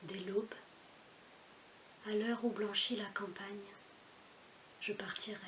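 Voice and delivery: measured recitation with long pauses; poem recital. Acted. (récitation posée d'un poème)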